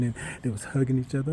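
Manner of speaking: ordinary talk, nothing marked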